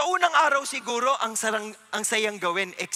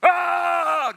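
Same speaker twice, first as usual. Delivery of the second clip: loud open battle cry; shout; 6 semitones higher, 6 dB louder than usual. Shown as a demonstration. Preacher showing the kids the Israelites' shout, loud. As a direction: shout it like a crowd. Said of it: "Ganun kayang sigaw?".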